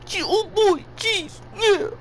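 high, goofy puppet voice; chirpy cartoon sock puppet; Shark Puppet voice. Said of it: "my best Shark Puppet voice".

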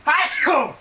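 a loud real sneeze. Real, not acted.

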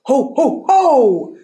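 long honks into a mock ho-ho laugh; hammed-up goose laughter; laughing gander. A narrator's reading. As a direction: honk and guffaw like a noisy gander. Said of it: "the gander laughed".

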